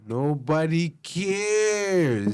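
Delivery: very slow, drawn-out words; emphatic dismissal. Real, not acted. Co-host dismissing talk of the old Republic, emphatic dismissal. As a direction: stretch each word to shut the argument down. Drowning out the other's argument.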